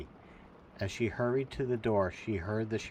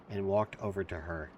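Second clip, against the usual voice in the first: ordinary delivery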